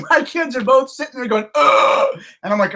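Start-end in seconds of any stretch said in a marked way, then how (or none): 1.5-2.2 high, loud imitated squeal; imitated kid squeal. Imitating his kids watching the movie, squealing. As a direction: mimic an excited child's noise. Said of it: "I hear this squeal off to the side".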